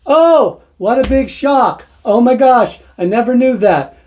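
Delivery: high-pitched feigned astonishment; sarcastic mock shock. Sarcastic feigned shock at known fact.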